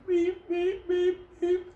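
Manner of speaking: high monotone "beep" on a steady pulse; alarm clock beeping. Retold or imitated. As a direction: mimic an alarm clock, one even pitch. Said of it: "like a fucking alarm clock".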